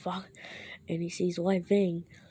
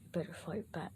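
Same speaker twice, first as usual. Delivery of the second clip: lightly voiced voice in his head, very soft; quiet warning from within; inner voice; 9 dB quieter than usual. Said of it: "the voice inside him says".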